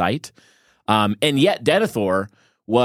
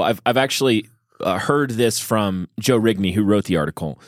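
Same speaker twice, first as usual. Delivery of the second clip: ordinary delivery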